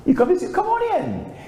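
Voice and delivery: high, bright welcoming mom voice; high-pitched friendly mother welcome; mother's greeting. Retold or imitated. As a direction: greet him like a cheerful mom at the door. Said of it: "And she says".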